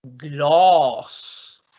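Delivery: "Gloss" with the vowel held very long. Shown as a demonstration. Teacher pronouncing the second word, long-vowel "Gloss". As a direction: stretch "Gloss" right out, slowly. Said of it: "¿Cómo se pronuncia la segunda?".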